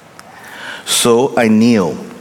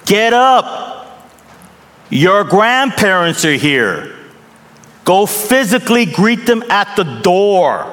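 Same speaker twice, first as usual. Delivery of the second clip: put-on high, firm parent ordering kids; stern parent; 8 semitones higher than usual. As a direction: call the kids out to greet. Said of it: "we say".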